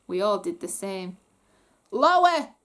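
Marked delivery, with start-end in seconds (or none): 1.9-2.5 loud, high royal order; loud imperious goblin command. Acted. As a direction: bark the order down at them. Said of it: "Grogbar commanded".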